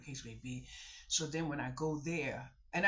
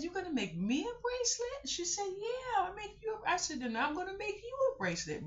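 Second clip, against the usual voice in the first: high sing-song bracelet chat; high playful child voice; child-directed talk. Retold or imitated. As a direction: play along sweetly with a kid.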